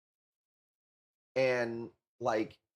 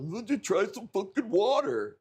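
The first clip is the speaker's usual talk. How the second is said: mocking Randall; 6 semitones higher than usual. Streamer mimics his friend's nagging advice, mocking high voice. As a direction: mimic a pushy buddy scoffing at him. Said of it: "And then here's fucking Randall".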